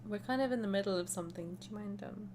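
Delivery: soft, trailing-off embarrassed murmur; awkward quiet excuse. Acted. Heroine caught by a visitor, awkward hushed excuse. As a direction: shrink the voice down to a hesitant end.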